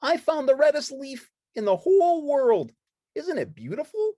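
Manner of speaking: high, bright-eyed puppet chatter; Ernie voice. Acted. Ernie showing friends the autumn woods, high and eager.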